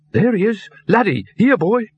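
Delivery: high-pitched calling to a dog; relieved. A narrator's reading. Trainer spots the lost dog in the alley, relieved. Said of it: "The words dripped relief".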